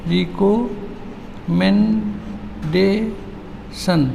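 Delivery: "Recommendation" softly and very slowly, long gaps between beats; split into syllables. Shown as a demonstration. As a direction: softly draw "recommendation" apart for counting. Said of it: "क्या टुकड़ा होगा".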